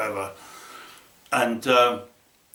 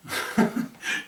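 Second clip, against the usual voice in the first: breathy laugh; 4 dB quieter than usual. Real, not acted. Laugh after owe me a beer.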